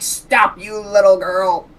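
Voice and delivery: high, loud anime battle cry. Acted. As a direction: shout it like an anime hero. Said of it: "using his anime voice".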